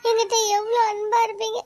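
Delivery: high, loud, tearful reproach; crying complaint. Acted. Wife crying at her husband about his sister staying. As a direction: pour out the hurt while crying. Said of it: "இப்போ எதுக்குடி அழுதுட்டு இருக்க?".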